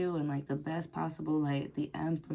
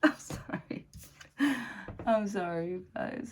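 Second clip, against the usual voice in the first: laughing apology; 3 semitones higher than usual. Real, not acted. Apologizing through a laughing fit.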